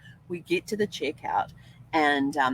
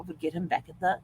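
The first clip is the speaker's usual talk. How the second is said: normal talking voice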